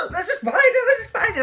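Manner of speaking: high, fast, laughing squeal imitating the kids; imitating her kids panicking over a spider; mock panicked kids. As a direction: shriek the warning like a frightened child. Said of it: "my kids used to say".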